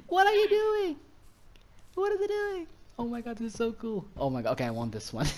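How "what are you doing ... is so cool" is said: extremely high, giddy shrieks easing slightly; overjoyed high-pitched gushing; delighted squealing. Real, not acted. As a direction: squeal with amused wonder. Watches panda antics in delight.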